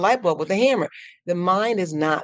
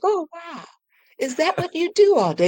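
amazement and wonder; 11 semitones higher than usual. Shown as a demonstration. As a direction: marvel at your busy mind like a child. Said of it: "watch it with amazement and wonder".

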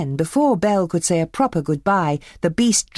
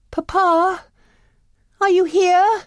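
high, louder call into the castle; 5 dB louder, slower than usual. Acted. Belle searches the dark castle for her father, calling out. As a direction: call out for Papa through the halls. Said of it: "cried Belle".

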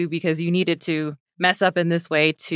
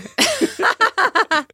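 high bright laugh; real high laugh; 3 dB louder than usual. Real, not acted. Laughs at own joke.

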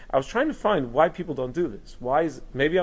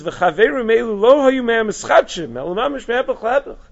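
animated quote; 8 semitones higher, 6 dB louder than usual. Retold or imitated. Teacher quoting the rabbi's confession, excited and loud. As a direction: read the rabbi's words with rising fervor. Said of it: "And he says".

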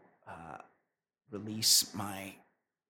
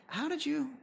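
incredulous; 12 semitones higher, faster than usual. Retold or imitated. Imagined question to urologist.

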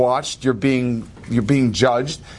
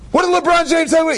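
high-pitched frantic crowd question; excited, much higher-pitched yell; excited mob shout; 13 semitones higher, 3 dB louder than usual. Retold or imitated. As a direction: cry it out like a swarming fan.

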